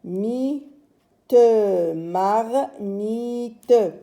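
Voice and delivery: "Mi, te, mar, mi, te" in separate stretched beats; syllable by syllable. Shown as a demonstration. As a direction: chain "mar, mi, te" slowly. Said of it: "on va lire maintenant le mot".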